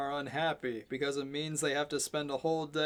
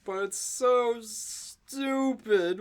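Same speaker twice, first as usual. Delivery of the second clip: usual speaking voice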